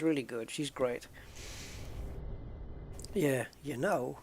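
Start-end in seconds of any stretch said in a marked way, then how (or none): 3.6-4.2 Christopher Walken. Retold or imitated. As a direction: drawl it in imitation of a film star. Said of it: "I can do the Christopher Walken".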